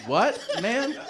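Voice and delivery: high, laughing disbelief; stunned laughing what. Retold or imitated. Patient hearing he survived by being fat, laughing disbelief. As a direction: squeak it out in disbelief.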